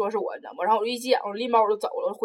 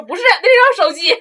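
higher, louder insistence; mock stubborn teacher; 8 semitones higher, 9 dB louder than usual. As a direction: imitate the teacher refusing to listen. (模仿老师固执地坚持)

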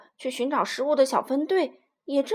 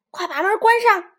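yelled order; 8 semitones higher, 9 dB louder than usual. Acted. Innkeeper yells from inside.

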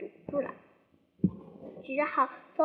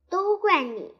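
louder mock-angry retort; mild angry retort; 4 semitones higher, 6 dB louder than usual. Rabbit angrily blames Pooh for eating too much. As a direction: snap back the blame, lightly. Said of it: "兔子也生气地说".

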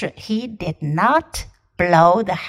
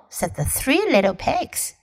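usual speaking voice

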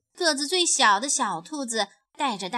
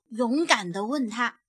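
ordinary delivery, nothing marked

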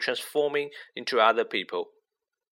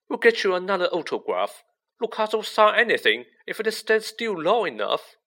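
Ron, impatient; 9 semitones higher, 4 dB louder than usual. A narrator's reading. Ron's voice in narration.

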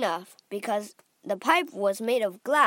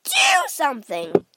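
loud, high-pitched demand; wife yelling at her husband; screaming; 4 semitones higher, 7 dB louder than usual. A narrator's reading. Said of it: "screamed at Mister Gloop".

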